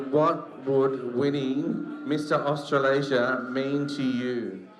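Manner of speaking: slow and deliberate for the crowd; slow, clear repeat. Shown as a demonstration. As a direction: spell it out slowly. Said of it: "Armand said".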